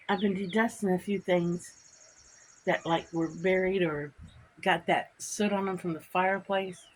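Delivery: ordinary delivery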